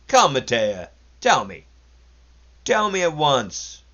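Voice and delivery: loud, high, pressing demand; insistent. A narrator's reading. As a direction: push him to spill it, slowing. Sergei presses Matea to tell.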